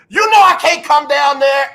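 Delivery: high, loud call down, acted for laughs; mock shouted reply. Fan shouting down to the coach, mock loud. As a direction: yell it back down cheekily. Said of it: "the dude said".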